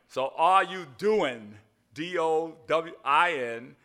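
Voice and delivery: slow, loud, spelled out letter by letter; slow spelled demo. Shown as a demonstration. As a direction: spell it slowly to the room. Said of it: "text spelling demo".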